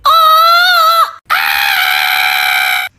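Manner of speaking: loud shrieks chopped off short; staged cut-off screams; abruptly cut scream. Shown as a demonstration. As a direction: scream and stop dead. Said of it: "abruptly cut scream videos".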